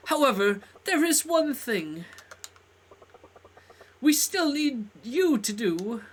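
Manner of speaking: grand character voice. Acted. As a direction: declaim with showy delight. Voices a theatrical game character.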